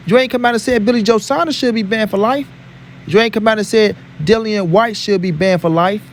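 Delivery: ordinary talk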